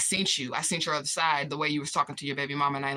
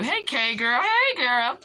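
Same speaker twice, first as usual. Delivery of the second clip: high squealed hello, louder; real delighted, sing-out greeting; excited greeting; 7 semitones higher, 4 dB louder, much slower than usual. Real, not acted. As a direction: greet a friend with pitch soaring. Calling out greeting to a viewer.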